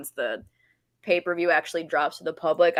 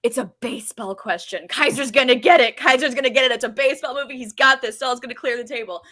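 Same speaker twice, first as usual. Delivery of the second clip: loud, higher, excited chatter; rooting out loud, pitched up; 4 semitones higher, 5 dB louder than usual. Retold or imitated. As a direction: re-enact giddy anticipation at the screen. Huddled friends retell excited cheering on.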